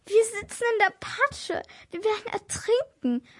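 high-pitched, panicky voice; panicked. A narrator's reading. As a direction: squeak it out in rising panic. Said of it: "flüsterte ich panisch".

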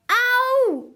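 a sudden piercing cry of someone hurt; shocking scream of pain from upstairs. A narrator's reading. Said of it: "derselbe Schrei wie am Vortag".

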